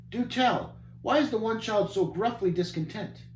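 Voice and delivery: high, wondering question; puzzled. Acted. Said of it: "somewhat in a puzzlement".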